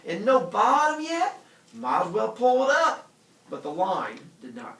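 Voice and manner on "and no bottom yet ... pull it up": loud amazed voice. Acted. Calvin amazed.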